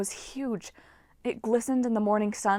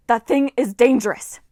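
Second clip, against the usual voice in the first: loud furious warning; angry shout; 3 semitones higher, 11 dB louder than usual. A narrator's reading. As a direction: bellow at the crowd. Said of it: "an Ares girl shouted".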